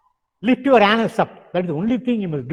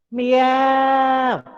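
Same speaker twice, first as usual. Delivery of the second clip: high, long meow, jaws pulled back; cat meow with jaws back; 5 semitones higher than usual. Shown as a demonstration. Teacher shows the right way to meow, jaws pulled back. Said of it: "the jaws must be pulled back".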